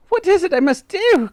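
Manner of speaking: high, loud desperate question; wife crying out. A narrator's reading. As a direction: demand it in a pleading cry. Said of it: "cried Miss Twit".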